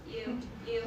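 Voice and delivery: ordinary talk, nothing marked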